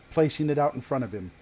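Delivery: ordinary talk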